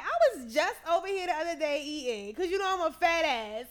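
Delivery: big, brash quoted voice; acted loud chatter. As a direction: impersonate a loud, unabashed friend. Acted companion chattering loudly to driver.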